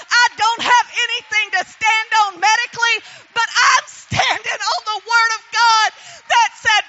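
loud, ringing preacher's voice; full force preaching. Acted. Testifying to the congregation about her faith, preaching loud. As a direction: preach it out to the back row.

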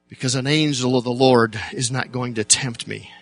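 ordinary talk

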